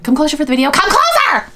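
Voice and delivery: building to a high, loud screech; imitated yelling. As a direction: mimic a mother yelling, rising to a shriek. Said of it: "Like, yelling at the kid".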